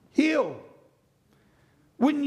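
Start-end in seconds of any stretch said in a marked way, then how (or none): 0.1-0.6 loud, high, hammed-up heal; mock healing command. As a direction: bark it like a desperate healer. Disciples stand over boy commanding.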